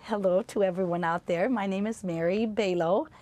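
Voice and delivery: normal talking voice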